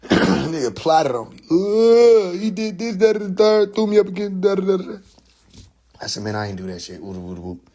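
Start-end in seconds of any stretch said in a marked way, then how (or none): 1.5-5.0 high, hammed-up wail; mock crying. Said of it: "just activated his crydom". 6.2-7.6 low, soft grumble; subdued, low-pitched retold protest. Retelling his own low protest.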